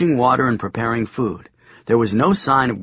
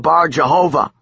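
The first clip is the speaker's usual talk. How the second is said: put-on defiant boy; 5 semitones higher, 5 dB louder than usual. A narrator's reading. Joshua repeating defiantly.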